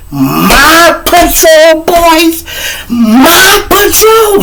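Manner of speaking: slow, high, drawn-out shriek; explode, stretching each word high; snapping in outrage. Acted. Miss Washington snapping over her patrol boys, outraged shriek. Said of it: "She snapped".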